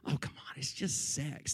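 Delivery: softly muttered, brushing it off; dismissive mutter. Retold or imitated. Preacher voices a listener's thought, dismissive mutter.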